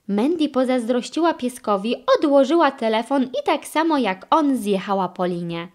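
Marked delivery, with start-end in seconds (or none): none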